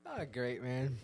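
ordinary delivery

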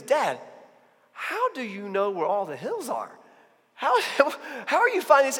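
high, loud, wondering son; amazed kid. Retold or imitated. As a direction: ask it like a delighted boy. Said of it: "he goes".

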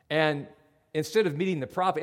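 ordinary talk, nothing marked

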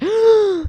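loud, high mock gasp; shocked crowd gasp. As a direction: imitate a whole room gasping. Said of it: "the whole room was like".

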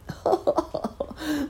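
high whispered chuckle; amused chuckle. A narrator's reading. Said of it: "laughed Uncle Wiggily".

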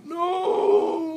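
very high, loud hysterical cry; hysterical scream. Acted. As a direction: scream it in sheer terror. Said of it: "Frank hysterically".

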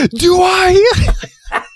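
high, shouted, near-cry panic; panicked shout. Retold or imitated. Imitating the crewman freaking out over his name, high panicked shout. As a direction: scream it in total panic. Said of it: "He's like".

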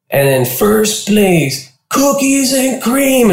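slow, high winner reveal; announcer reveal. Retold or imitated. Host reveals first place, slow and high. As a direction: build up the big name. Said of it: "he goes".

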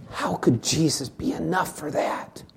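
soft, almost whispered question; hushed despairing question. Real, not acted. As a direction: ask it in a hollow hush.

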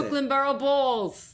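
loud Brooklyn, broad "Borough"; Brooklyn accent. Pretend. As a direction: belt it like a Brooklyn native. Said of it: "Say it".

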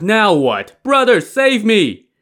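high, loud call for rescue; panicked cry. A narrator's reading. Li Kui, feet stuck, calls his brother, panicked. Stuck man cries for help.

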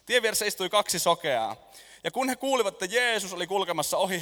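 usual speaking voice

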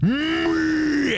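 high, shouted demonic rage; demon roar. Acted. Demon bunny raging at the summoner, shouted. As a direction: bellow it like a furious demon.

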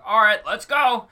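loud, high mock rallying call; hammed-up call to action; imitating gung-ho director. Director with a camera in a parking lot, imitated rallying call. Voices director springing a shoot.

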